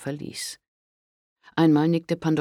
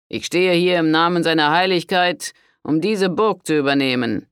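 pompous lord; 5 semitones higher, 6 dB louder than usual. A narrator's reading. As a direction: announce the pope's will with authority. Enemy lord's formal demand. (selbstgefälliger Conte, feierlich)

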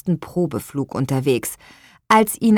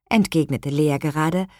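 ordinary delivery, nothing marked

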